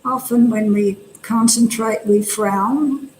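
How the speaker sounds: calm body-scan voice, long silences between phrases; guided meditation. Real, not acted. Teacher guiding meditators to relax the face, calm and unhurried. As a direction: guide them gently, leaving space after each instruction.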